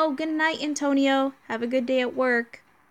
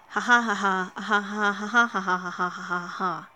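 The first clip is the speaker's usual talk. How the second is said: villain laugh. Acted. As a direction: cackle like a gloating villain. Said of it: "I'm sounding like a villain".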